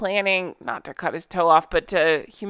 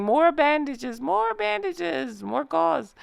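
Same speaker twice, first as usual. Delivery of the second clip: light mock French accent, higher and slow; a hammed-up French nurse calling for supplies; playful French accent, drawn out; 6 semitones higher, 3 dB quieter, slower than usual. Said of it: "She s-, appeared to be French".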